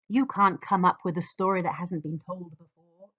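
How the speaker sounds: ordinary delivery, nothing marked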